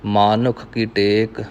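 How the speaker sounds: ਮਾਨੁਖ as maa-nukh, long first vowel. Shown as a demonstration. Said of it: "ਉਹ ਮੰਮੇ ਨੂੰ ਕਰਨਾ ਹੈ". (ਮਾਨੁਖ ਵਿੱਚ ਮੰਮੇ ਨੂੰ ਕੰਨਾ)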